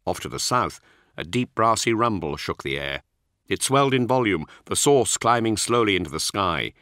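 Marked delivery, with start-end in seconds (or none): none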